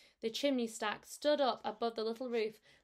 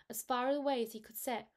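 ordinary delivery, nothing marked

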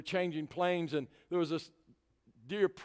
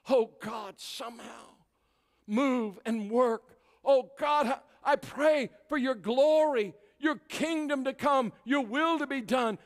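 high, loud pleading prayer; impassioned prayer, building to preaching force; fervent prayer; 8 semitones higher, 6 dB louder, slower than usual. Retold or imitated. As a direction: cry out to God with rising intensity. Re-enacts praying at the Berlin Wall.

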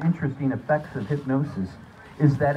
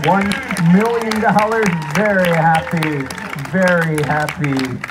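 triumphant; 4 semitones higher, 10 dB louder, slower than usual. Acted. Reward cue.

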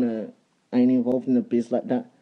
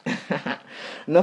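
normal talking voice

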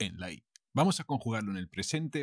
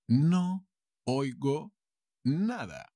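higher pitch, a clear pause after each word; 4 semitones higher, slower than usual. Shown as a demonstration. Teacher repeats for learners, word by word.

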